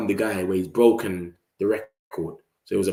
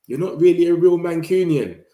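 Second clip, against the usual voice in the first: high mocking voice, a bit louder; higher sneering jab; mocking rival fan; 7 semitones higher, 4 dB louder than usual. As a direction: taunt him like a rival fan. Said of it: "I only get told that".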